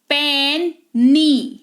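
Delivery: "पेनी" split into a long "pen", pause, long "nee"; "पेनी" as two drawn-out, separate efforts; two separate syllables. Shown as a demonstration. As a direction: break "पेनी" in two, very slowly. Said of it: "ये सारे दो syllable हैं".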